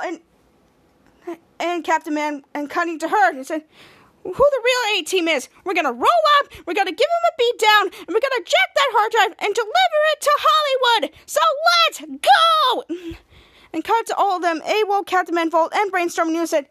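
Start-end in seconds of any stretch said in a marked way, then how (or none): 4.2-12.8 rallying battle cry. Acted. Shadow rallying the team to take back the drive, building excitement. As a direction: fire up the team.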